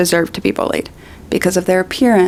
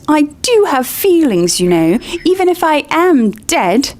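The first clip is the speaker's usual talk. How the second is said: high, wounded wail; hurt and tearful; tearful protest; 7 semitones higher than usual. Acted. Myrtle protests to Hermione, tearful and high. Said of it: "voice choked with tears".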